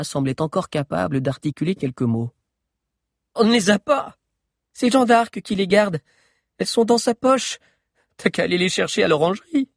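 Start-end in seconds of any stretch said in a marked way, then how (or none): 3.3-9.7 put-on higher girl's voice, defiant. A narrator's reading. Guard defying the giant. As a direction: throw the challenge back. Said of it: "un regard de défi".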